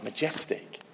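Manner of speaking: Majestic whispered, breath only; awed whisper. Acted. Preacher answering what God is like, in an awed whisper. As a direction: breathe the word in awe.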